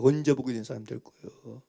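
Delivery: low, soft echo of the question; soft repeat. Real, not acted. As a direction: drop it to a soft, low ask. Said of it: "repeats question softly".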